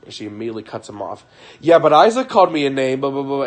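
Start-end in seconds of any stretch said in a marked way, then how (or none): 1.6-3.4 louder, higher, cutting in fast to protest; mimicking his wife cutting the pastor off; defensive interruption. Wife interrupting the pastor, mocked as indignant.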